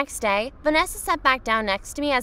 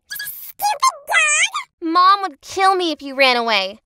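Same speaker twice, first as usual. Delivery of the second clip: put-on high, drawn-out bratty girl voice; bratty whine, much higher; Vanessa voice; 9 semitones higher, 6 dB louder, slower than usual. S0 voices Vanessa scolding dog.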